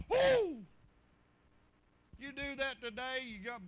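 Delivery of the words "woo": high, loud whoop; excited holler; loud "woo". Real, not acted. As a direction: whoop at the memory. Exclaims after ruler story.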